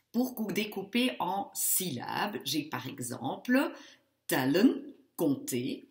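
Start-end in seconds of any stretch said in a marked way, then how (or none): 4.3-4.8 tellen with a short first E and louder delivery. Shown as a demonstration. Teacher giving an example to students, tellen with a short E. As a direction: keep the first E of tellen brief. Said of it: "le E est court".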